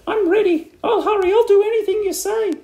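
very high, loud frantic outburst; hammed-up panicked wife; crying out. A narrator's reading. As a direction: blurt it out high in desperate eagerness. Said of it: "cried Missus Twit".

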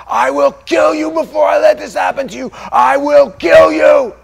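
high, loud furious yell; father yelling. Retold or imitated. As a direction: roar the threat as the father.

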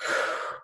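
slow, audible exhale; deep breath out. Shown as a demonstration. Reader showing the mother's deep breath, soft exhale. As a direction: let out a soft, calming breath. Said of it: "Her mother took a deep breath".

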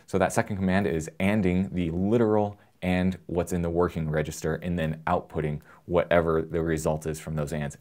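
ordinary talk, nothing marked

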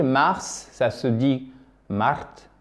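ordinary talk

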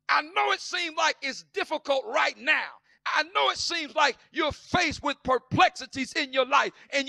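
fiery sing-song whoop, shouted high; preaching at full force. Acted. Preacher urging the church to hold on, in fiery whooping. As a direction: build the congregation up in a chanted whoop.

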